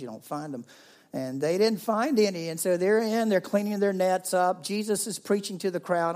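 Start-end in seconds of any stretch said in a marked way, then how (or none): 1.1-6.2 louder preaching storytelling; full force preaching. Acted.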